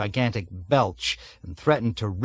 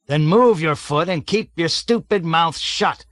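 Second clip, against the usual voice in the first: loud, higher barked rebuke; angry shout; 6 semitones higher, 4 dB louder than usual. A narrator's reading. Colonel shouts down Major Metcalf angrily. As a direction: tear into him.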